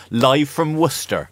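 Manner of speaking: high, slow West Country announcer; mock West Country accent; put-on West Country accent. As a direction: draw out a jokey West Country broadcast call. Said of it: "I don't know why I've put that accent".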